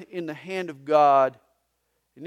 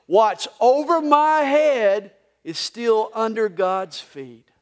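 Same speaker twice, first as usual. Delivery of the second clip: slow, louder refrain line; said together; 5 semitones higher, 10 dB louder, slower than usual. Shown as a demonstration. Pastor repeating the slow refrain with the congregation. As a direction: lead the room through it slowly. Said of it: "One more time".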